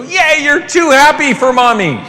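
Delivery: sing-song fake praise from mommy; mock cheering mom. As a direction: parent sarcastically cheering a too-happy child. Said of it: "celebrate us and go".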